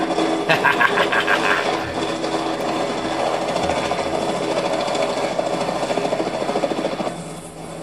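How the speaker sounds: long wheezing airy laugh; sustained breathy laughter. Real, not acted. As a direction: crack up for a long while. Laughing at helicopter landing.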